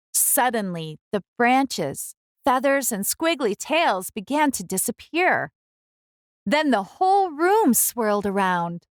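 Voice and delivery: ordinary delivery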